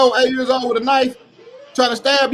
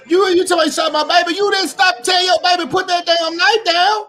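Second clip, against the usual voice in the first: angry shout; 6 semitones higher than usual. Real, not acted. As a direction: chew him out at full volume. Heated rant scolding the father.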